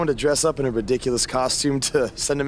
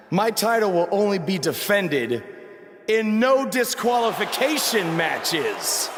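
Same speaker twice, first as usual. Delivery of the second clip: slow, drawn-out and higher, spelled out for effect; dramatic announcement; 7 semitones higher, slower than usual. Acted. Champ to rival, stating his terms in a slow dramatic proclamation. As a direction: lay down the condition slowly, for effect.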